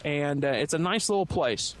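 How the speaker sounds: ordinary delivery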